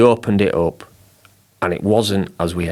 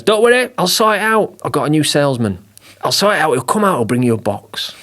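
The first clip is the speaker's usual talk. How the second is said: much higher, eager reassurance; lightly voiced salesman promising to fix it; reassuring boss; 7 semitones higher than usual.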